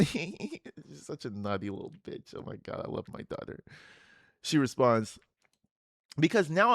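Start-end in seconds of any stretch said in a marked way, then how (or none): 0.0-3.6 fond whisper. Real, not acted. Fond aside after reading.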